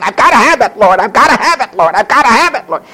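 high, insistent mock begging; obsessive pleading. Preacher mimicking Hannah's praying, obsessive pleading. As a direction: beg on and on in a repeated singsong. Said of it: "involved in praying for this one thing".

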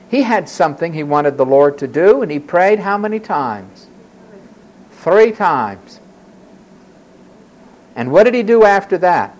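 ordinary talk

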